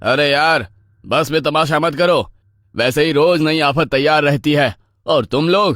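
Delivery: high, exasperated scolding; angry driver. Acted. Driver scolding brawling passengers after braking, exasperated. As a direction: tell them off, fed up. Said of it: "driver ने गुस्से में".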